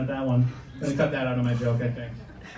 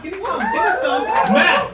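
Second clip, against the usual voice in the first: high, loud crowd-rousing yell; hyped-up shout; 9 dB louder than usual. Acted. Comic hyping his hometown to the audience, high excited shout. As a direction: whoop it up for the crowd.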